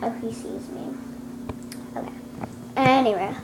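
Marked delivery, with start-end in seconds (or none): 0.0-2.1 whispering. Real, not acted. Sneaking up to film cat.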